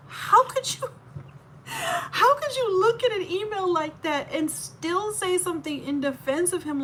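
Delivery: plead, near tears, in disbelief; real upset disbelief. Real, not acted. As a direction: voice cracking high with real distress.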